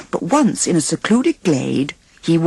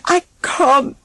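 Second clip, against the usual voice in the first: cried; 9 semitones higher, slower than usual. A narrator's reading. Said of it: "he cried".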